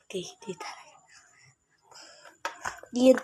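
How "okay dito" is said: hushed whisper from a hiding spot; whispering while hiding. Real, not acted. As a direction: whisper so nobody finds you. Hiding during a hide challenge.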